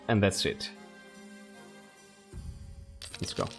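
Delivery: usual speaking voice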